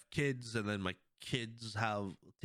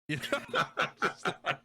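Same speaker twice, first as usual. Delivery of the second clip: laughing through the words; breaking into laughter mid-line; 5 semitones higher, 4 dB louder than usual. Real, not acted. Podcaster cracking up retelling the Professor gag, laughing.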